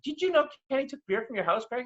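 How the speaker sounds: lightly voiced tattling girlfriend. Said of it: "Ivanka's like".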